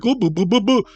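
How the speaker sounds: mocking a clueless speaker with nonsense babble; mock dumb guy. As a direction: play the candidate as a babbling fool, lightly. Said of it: "if I was trying to be like, "This guy is so dumb".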